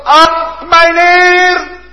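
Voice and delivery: high, loud cry for help; desperate cry. Acted. Sinking Peter crying out to his Lord, high and desperate. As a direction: cry out as if drowning.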